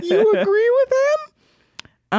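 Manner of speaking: very high, slow wailing disbelief; appalled cry, almost squealing; shocked disbelief. Retold or imitated. Asking girlfriend in disbelief if she agrees with him, high wailing cry.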